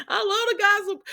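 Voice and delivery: high, gleeful I love the gossip; high gleeful repeat. Real, not acted. Vlogger admitting she loves gossip, high and gleeful. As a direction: sing it out high.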